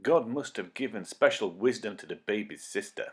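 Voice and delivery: ordinary delivery, nothing marked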